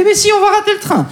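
very high, insistent fretting mother; a mother's high panicked insistence; panicky mother. Retold or imitated. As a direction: wail it back at him, certain of doom.

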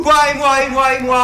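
high, loud comic protest; indignant retort. Acted. Partner answering the accusation, indignant high shout. Acted quarrel, indignant reply.

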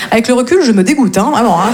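usual speaking voice

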